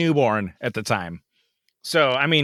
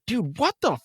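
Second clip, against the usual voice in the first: higher, sharp angry outburst; mad yell; 7 semitones higher than usual. Retold or imitated. Driver swearing at a reckless car, angry. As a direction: swear at the car that cut him off. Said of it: "I was mad".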